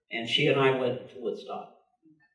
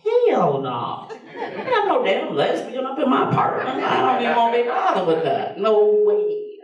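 high, scandalized mock outrage; retold flat refusal, pitched way up; indignant refusal; 9 semitones higher than usual. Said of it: "And I was like".

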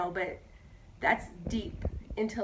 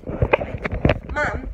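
loud, higher imitation of a nerdy kid; nerd voice; 4 semitones higher, 9 dB louder than usual. Mother imitating how her nine-year-old son talks, nerd voice. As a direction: mimic a little nerd, punched up loud. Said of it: "he talks like this already".

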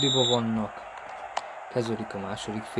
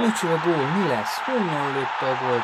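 excited hungarian sports commentary; 2 semitones higher than usual. Real, not acted. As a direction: call the goal like a live commentator. Live excited match commentary.

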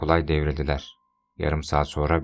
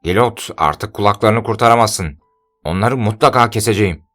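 taunting shout; 5 semitones higher, 9 dB louder than usual. Acted. Pardayan taunts from outside.

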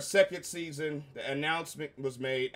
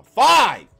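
yelled high and loud; exasperated shout; 8 semitones higher, 17 dB louder than usual. Real, not acted. Reviewer yelling at fans about how many episodes. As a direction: blurt it out in disbelief.

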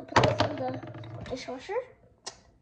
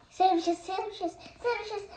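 put-on higher chanting kid; excited kid voice; 3 semitones higher than usual. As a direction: chant it like an eager toddler. Doll chanting food.

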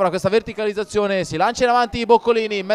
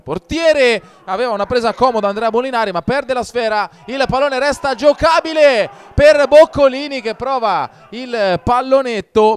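higher, louder rush at the chance; excited commentary; 5 semitones higher, 6 dB louder than usual. Real, not acted. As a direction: climb with the attack. Near goal in live commentary.

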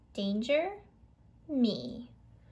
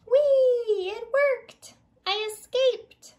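much higher and louder, a bouncing cry of joy; gleeful excitement; 10 semitones higher, 8 dB louder than usual. Acted. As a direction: whoop it like a kid on a slide. Hot dog escapes rolling away.

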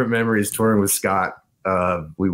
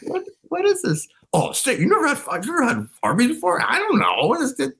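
much higher, confused mock voice; imitating Stigma. Friend imitating Stigma puzzled by five for five, high mock voice. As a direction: play a baffled friend hearing of Arby's, way up high. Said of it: "Stigma going like".

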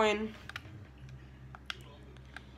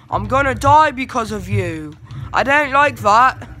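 frustrated shout; 3 semitones higher, 8 dB louder than usual. Real, not acted. Teammate bot getting him killed.

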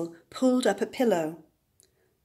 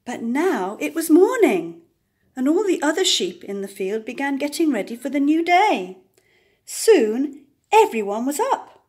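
brisk, higher and louder; bright bustling morning; lively; 5 semitones higher, 6 dB louder than usual. A narrator's reading. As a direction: wake the field up with energy.

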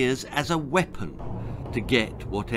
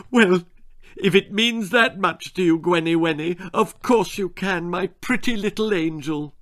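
loud, high, doting tone; doting father; 6 semitones higher, 3 dB louder than usual. Acted. Father giving in to his daughter, doting. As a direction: melt for your little girl.

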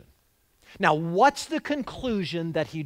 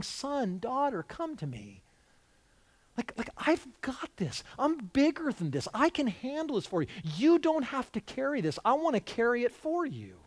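soft, higher, tender reassurance; loving father; 5 semitones higher, 5 dB quieter than usual. Shown as a demonstration. A dad calls his overwhelmed child to him, tender and reassuring. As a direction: comfort an anxious child as a caring dad. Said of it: "That's the voice you should hear".

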